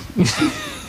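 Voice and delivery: hohes kicherndes Auflachen; hohes Lachen. Real, not acted. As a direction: kichere hoch auf. Laughs at own joke. (hohes Kichern)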